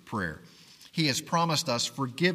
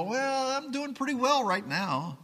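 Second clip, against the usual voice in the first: mock self-satisfied, pitched much higher; smug self-reliance; 10 semitones higher than usual. Preacher mocking complacent believers, smug and high. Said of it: "we get so wrapped up in ourselves and go".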